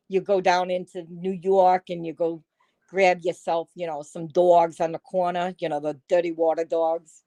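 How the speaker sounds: lightly put-on New York street accent. Shown as a demonstration. Streamer showing viewers her best New York voice, New York accent. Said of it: "I could do a New York accent".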